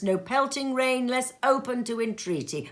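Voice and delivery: ordinary delivery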